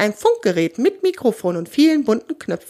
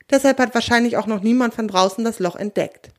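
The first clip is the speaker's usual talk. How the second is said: usual speaking voice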